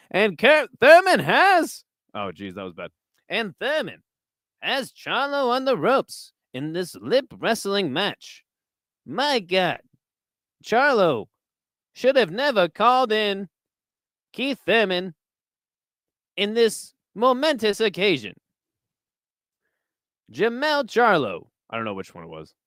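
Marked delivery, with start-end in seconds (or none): 0.1-1.8 high, loud, drawn-out sportscaster delivery; botched, over-the-top ringside commentator; Howard Cosell commentating. Acted. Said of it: "Impression of Howard Cosell commentating". 3.3-21.5 raised, swooping sportscaster cadence; halting, grandiose ringside call with big pitch arcs; Howard Cosell commentating. Acted. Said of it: "Impression of Howard Cosell commentating".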